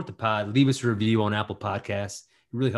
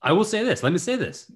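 higher-pitched, insistent demand to be heard; serious insistence; 8 semitones higher than usual. Real, not acted. Co-host trying to get a word in, serious and insistent. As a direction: insist on getting the floor, pitch jumping up. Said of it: "you sound serious".

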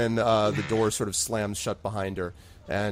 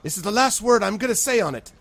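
put-on higher, louder stern old woman; stern final warning; 10 semitones higher, 6 dB louder than usual. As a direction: lay down the last word. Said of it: "Frida's like".

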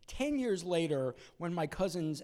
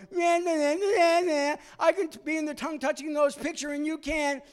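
taunting singsong; 10 semitones higher, 5 dB louder than usual. Retold or imitated. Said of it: "and I go".

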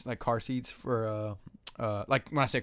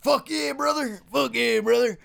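country dude voice; 11 semitones higher, 5 dB louder than usual. Pretend. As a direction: whoop like a hammed-up country boy. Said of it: "country dude".

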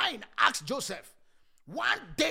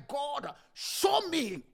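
raised, drawn-out plea; crying out to God; 6 semitones higher, 5 dB louder, much slower than usual. Acted. Congregant crying to God for direction, crying out. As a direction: cry it out higher and slowly to God. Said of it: "you are going to cry".

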